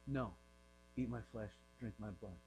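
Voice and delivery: low, hushed, unyielding refusal; quiet insistence. Retold or imitated. Jesus refusing to explain to the crowd, quietly insistent. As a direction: imitate a teacher who softly won't budge.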